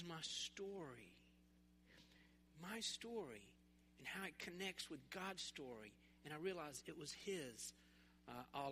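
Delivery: sincere and near-whispered testimony; soft reflection. Real, not acted. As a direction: let it sink in quietly. Sermon's quiet reflective peak.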